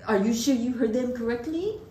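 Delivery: high, loud, alarmed manager voice; panicked boss demanding answers; alarmed manager questioning the server; frantic manager. Retold or imitated. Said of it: "manager came over and was like".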